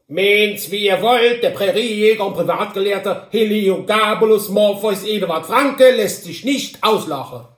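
high, loud, pompous little man in a huff; indignant Hobble Frank. Acted. Hobble Frank answers from his horse, loud and offended. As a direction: declaim your offended dignity loudly.

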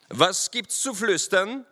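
high, slow, stern demand; lieutenant's sharp, high-pitched challenge; stern call. A narrator's reading. Lieutenant catches the riders whispering, stern. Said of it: "rief der Lieutenant in strengem Tone".

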